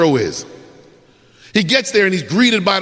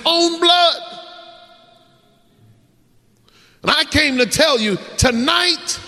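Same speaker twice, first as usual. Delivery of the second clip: higher, rising preacher's cry building to a shout; preaching at full force; 4 semitones higher than usual. Acted. Preacher calling young people to accept the pardon, fervent. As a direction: lift it to the climax of the sermon.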